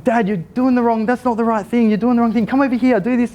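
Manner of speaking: put-on high, frustrated child scolding; impatient boy bossing his dad; upset kid. Son frustrated at dad's mistakes, high and scolding. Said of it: "he was getting upset with me".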